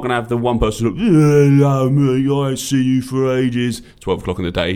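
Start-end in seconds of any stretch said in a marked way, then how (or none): 0.9-3.8 put-on loud, slow, drunken mate greeting; loud, drawn-out drunk regular at noon; drunk bloke. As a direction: bellow a slurring hello across the pub. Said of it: "he's, like, absolutely langers".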